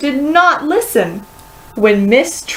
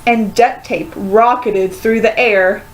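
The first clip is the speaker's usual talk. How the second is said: ordinary delivery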